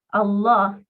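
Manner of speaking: Allah with a full, heavy lam. Shown as a demonstration. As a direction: say Allah with a thick emphatic lam. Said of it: "es con fuerza".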